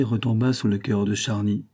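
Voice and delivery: plain reading voice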